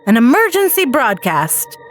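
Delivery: usual speaking voice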